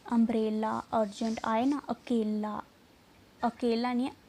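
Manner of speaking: ordinary delivery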